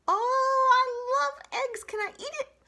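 drool over the egg like a hungry fox; greedy fox. Acted. Fox begging the robot for the egg, very high.

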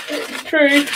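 said through a yawn. Real, not acted. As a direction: let a yawn stretch the words. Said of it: "Oh, I yawned again".

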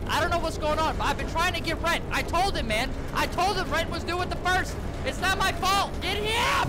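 high, hurried excuse building to a yell; mock-panicked pleading. Player blaming someone else to the approaching monster, frantic pleading. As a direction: plead frantically, ending in a shout.

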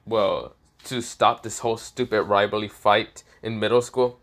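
usual speaking voice

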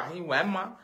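usual speaking voice

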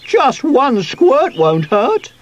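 high, slow squeaky voice; pleading squeak. A narrator's reading. Sweep argues for one squirt of spray, squeaking. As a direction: coax slowly in a squeak. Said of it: "squeaked Sweep".

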